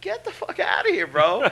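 high, scornful brush-off; mock dismissal. Mocking an arrogant kid, brushing him off high.

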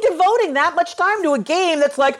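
ordinary talk, nothing marked

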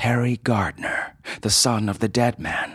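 usual speaking voice

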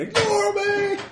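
very high squawked bird cry; parrot voice. Pretend. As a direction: screech it like a parrot. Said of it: "it would be better if you just said".

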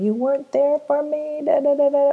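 put-on mild child-voice accusation; hurt child's reproach. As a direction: lightly voice the wounded child calling them out. Inner child voiced, reproaching parent.

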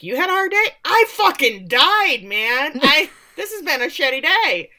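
loud, high-pitched incredulous rant; indignant outburst. Retold or imitated. Priest outdoing his friend's bad day, acted indignant outburst. Father indignantly topping Joe's complaint.

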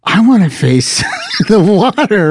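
high, laughing whine about the view; whining about the room, through laughter; laughing mock whine. Guest retelling his hotel complaint, laughing whine. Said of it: "And I was like".

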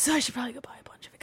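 breathy muttered aside under breath; whispered aside. Real, not acted. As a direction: whisper it to yourself.

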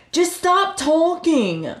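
high, drawn-out mock plea; exaggerated cut-her-off protest; imitated pleading. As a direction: stretch it out, pitched well up. Voicing his plea in argument.

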